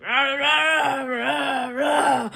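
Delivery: loud, wordless monster roars; Frankenstein growling. Acted. Frankenstein who doesn't really talk, roaring monster noises. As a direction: growl "rah" like a speechless monster. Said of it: "he doesn't really talk. He's like".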